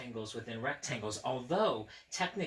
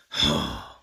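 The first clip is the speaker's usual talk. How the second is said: a much lower, loud theatrical sigh; mock sigh; 9 semitones lower, 10 dB louder than usual. Sighing over Grover's toothpaste mishap.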